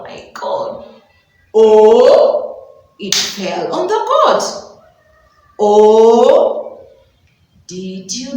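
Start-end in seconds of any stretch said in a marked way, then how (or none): 1.5-2.7 loud shocked oh; shocked gasp. Acted. Teacher telling the story, a loud shocked oh. Tree falls on goat. 5.6-6.9 loud dismayed oh; shocked cry. Acted. Teacher telling the story, loud dismayed oh. Goat stuck under tree.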